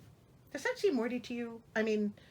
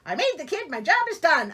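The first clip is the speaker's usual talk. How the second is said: a touch higher and louder, breezy and dismissive; mock careless king; 7 semitones higher, 8 dB louder than usual. Reader mocks the absent king, breezy. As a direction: toss it off like a lazy king. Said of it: "He's just like".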